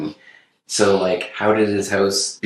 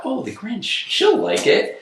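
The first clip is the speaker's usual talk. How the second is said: high, slow mock-cheerful thought, whispered at the end; sing-song high mock optimism; naive inner voice; slower than usual. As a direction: play your own naive hopeful self. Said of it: "'cause I thought".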